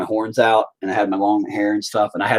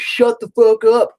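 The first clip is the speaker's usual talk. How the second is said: high, loud and drawn out; mock-shouting an insult; imitated troll yell; 11 semitones higher, 6 dB louder than usual. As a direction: holler it slowly like a heckler. Acts out the troll's abuse.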